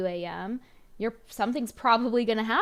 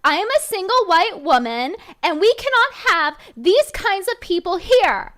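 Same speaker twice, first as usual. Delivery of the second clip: shrill hammed-up panicked Karen, much higher and louder; mocking Pearl; 9 semitones higher, 10 dB louder than usual. Host mocks Pearl's email, hammed-up high loud Karen voice. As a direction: overblown mock Pearl, rising in a panic. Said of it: "That's how Pearl sounds right now".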